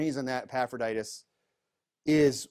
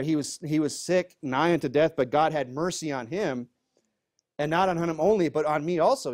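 raised, louder Bible-reading voice; scripture reading; 4 semitones higher, 5 dB louder than usual. Acted. Pastor reading scripture to the congregation, raised voice. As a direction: read the verse out with weight to the room. Rereads the verse with emphasis.

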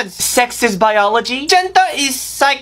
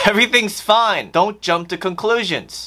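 ordinary talk, nothing marked

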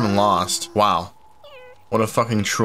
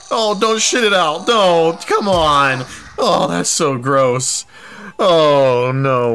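loud disgusted protest; 6 semitones higher, 5 dB louder, slower than usual. Real, not acted. Disgusted at character.

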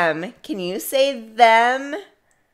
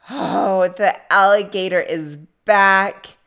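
ordinary talk

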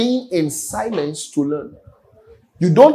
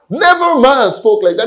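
excited emphatic quote; 6 semitones higher, 6 dB louder than usual. Acted.

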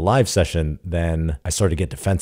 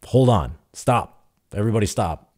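retold call to stop; 5 semitones higher, 3 dB louder than usual. Coach halts tense live session.

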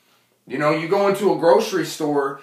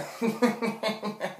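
soft laugh; 5 semitones higher, 11 dB quieter than usual. Real, not acted. Laugh after the bit.

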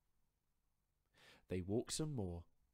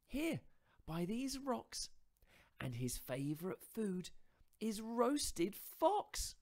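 higher, louder mouse voice, spinning a tall tale; boastful little mouse; 8 dB louder, slower than usual. Acted. Mouse inventing a monster to frighten fox, higher and louder.

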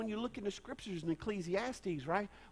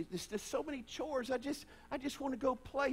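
put-on little girl whine; 8 semitones higher than usual. Pastor recalling the little girl who only wants to play, lightly high. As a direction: whine it like a small child. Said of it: "like that little girl".

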